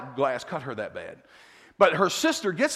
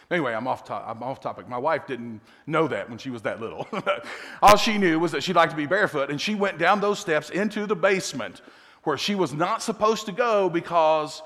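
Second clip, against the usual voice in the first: ordinary talk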